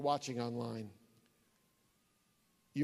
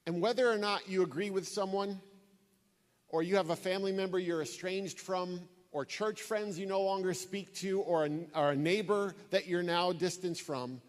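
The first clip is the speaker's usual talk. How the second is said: louder, impassioned sermon climax; preaching; 4 semitones higher, 5 dB louder than usual. Acted. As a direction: press the list home with full force.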